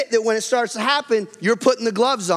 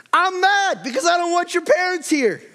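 shouted high mock complaint; mock angry shout; 9 semitones higher than usual. Preacher playing a fed-up husband shouting at his wife. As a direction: yell it like an exasperated spouse. Acted angry spouse confessions.

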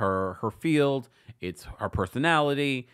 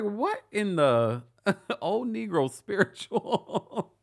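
mock disbelief; 9 semitones higher, much slower than usual. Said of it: "I was like".

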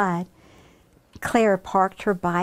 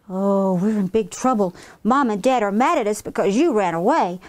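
plain reading voice

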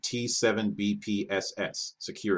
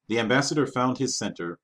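plain reading voice

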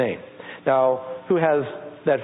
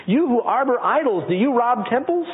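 raised, ringing scripture read; 8 semitones higher than usual. Acted. Preacher reading Romans to the church, raised and ringing. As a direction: proclaim it to the congregation.

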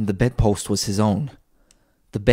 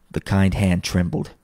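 ordinary delivery, nothing marked

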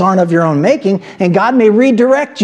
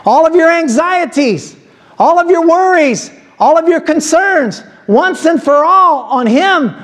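preaching at full force; 8 semitones higher than usual. Acted. Pastor exhorts the congregation to cast their cares, preaching at full force. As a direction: preach it with rising fire, slowing the key words.

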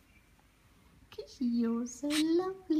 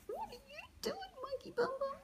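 very high, soft squeal then question; squeaky high laugh; 13 semitones higher, 9 dB quieter, faster than usual. Real, not acted. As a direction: squeal with delight. Squealing at parrot.

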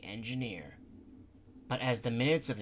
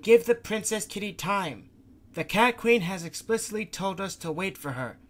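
louder grumbling that climbs higher and louder; exasperated rant; 4 semitones higher, 6 dB louder than usual. Acted. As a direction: build from gripe to near shout. Gonta rants, building up.

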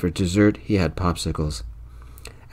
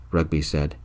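usual speaking voice

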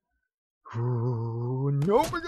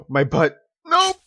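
panicked yelling; 12 semitones higher, 14 dB louder, much slower than usual. Real, not acted. Ragdoll mishap, panic.

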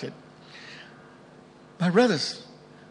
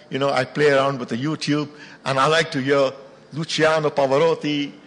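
usual speaking voice

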